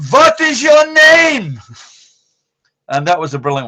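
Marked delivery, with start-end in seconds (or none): 0.1-1.6 high, loud and very slow, each word spelt out; loud slow foreigner-talk. Retold or imitated. Grandad slowly shouting his question at a German woman. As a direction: talk to a foreigner as if she's deaf. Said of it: "Grandad goes up to this woman and he goes".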